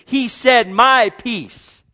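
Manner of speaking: loud, high declaration; loud proclamation. Acted. Preacher proclaiming to congregation, loud and high. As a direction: proclaim it full force. Repeated line, first take loud.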